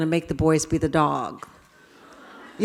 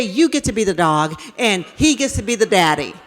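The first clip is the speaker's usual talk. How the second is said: usual speaking voice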